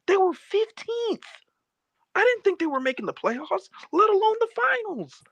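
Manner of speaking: very high, pleading exclamation; incredulous protest. Real, not acted. Loser explaining his bet to friend, incredulous.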